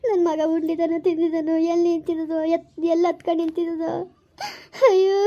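wailing lament, rising to a loud cry; keen tearfully for a lost child. Acted. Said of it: "please ಅಳ್ಬೇಡ ಕಣೆ". (ಅಳುತ್ತಾ ಗೋಳಿಡುವ ಧ್ವನಿ)

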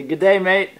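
a light Aussie "Good day, mate" prompt; Australian accent. Shown as a demonstration.

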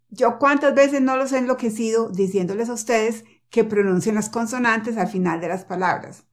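usual speaking voice

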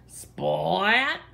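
breathy whispered splat; whispered bump. A narrator's reading. Narrator whispering the crash sound. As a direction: hush the sound effect. Bumping into Kitten.

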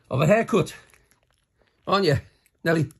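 high, sweet pet talk; talking to a dog. Real, not acted. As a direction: coo at the little dog.

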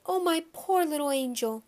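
high, pitying coo; distressed doting mother. A narrator's reading. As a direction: fuss over your poor baby. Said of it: "my mother cried".

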